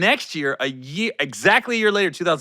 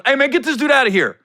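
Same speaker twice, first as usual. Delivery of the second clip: quick, high mock-angry command; angry order; 10 semitones higher, 4 dB louder, faster than usual. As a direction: throw him out, imitated outburst. Mike ordering stranger out, acted.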